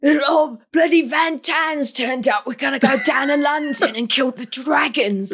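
overdone English accent mocking an actor. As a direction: ham up a bad English movie accent.

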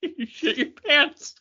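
usual speaking voice

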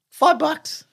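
high, slow outraged disbelief. Real, not acted. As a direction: stretch it out in shock. Outraged at Coke price.

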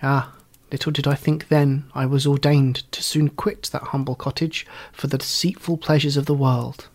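ordinary delivery

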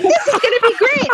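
high, hopeful and eager; naively excited. Retold or imitated. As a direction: chirp it like you expect the best. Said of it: "I'm like".

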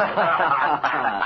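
smug gangster chuckle; mocking laugh. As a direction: laugh in their faces. Gangster gloats over sheriff.

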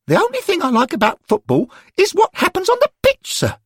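a grumbling lad who hates lessons, sir; schoolboy Derek. A narrator's reading. Derek complaining to the angels about facts, high and sulky.

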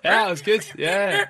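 high, loud delighted praise; excited. Real, not acted. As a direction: gush with delight at the impression.

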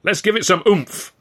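loud, high rallying cry; bellowing. A narrator's reading. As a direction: whip up the crowd with a bellow. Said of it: "Ed bellowed".

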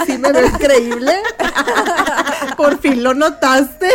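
laughing through the words, drawn out slowly. Real, not acted. Laughing as she boasts to her co-hosts that she looks amazing. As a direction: giggle while you claim the compliment. Jokingly accepting a compliment.

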